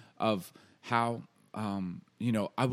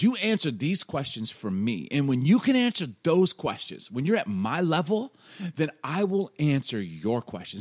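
high, emphatic, slow put-down; God; 5 semitones higher, 5 dB louder than usual. Acted. God going off on Job, slow emphatic rebuke. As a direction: lay down terms from far above him. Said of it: "God just goes off on him".

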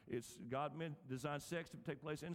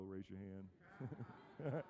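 slow, drawn-out raise your hand; 6 semitones lower, 4 dB quieter, much slower than usual. Real, not acted. Pastor teasing congregation about Skyline, drawn out slowly. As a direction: stretch out hand with a rising end.

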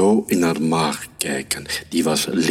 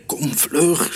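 high-pitched hurried plea; urgent summons; 11 semitones higher than usual. A narrator's reading. Dog jumping up and down fetches the hunter, urgent. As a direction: urge them to come, voice pitched up.

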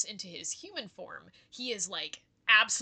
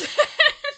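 big laugh; 14 dB louder than usual. Real, not acted. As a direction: burst of high loud laughter. Laughing at own story.

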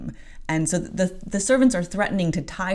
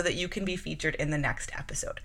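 whisper; 8 dB quieter than usual. Real, not acted. Host to listeners, whispered off. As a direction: drop to a breathy whisper. Trailing podcast sign-off.

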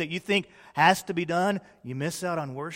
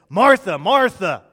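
sharp, loud, higher scolding call of the name; angry way; 4 semitones higher, 15 dB louder than usual. Retold or imitated. Jesus scolding Martha for her distraction, loud and angry. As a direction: bark the name like an impatient scold. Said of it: "I don't think Jesus said it in a angry way".

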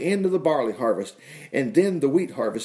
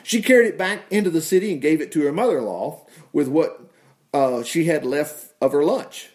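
usual speaking voice

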